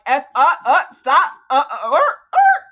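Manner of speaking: loud, flustered stammer; mock stammering. Mocking a silent character who should have objected, loud sputter. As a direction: sputter the half-started word. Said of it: "you could have been like".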